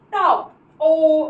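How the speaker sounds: टॉप stretched slowly, lips rounded on the ओ; slow O demo. Shown as a demonstration. Teacher showing students the mouth shape, slow demo. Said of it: "देखो मुंह ऐसे बन रहा है".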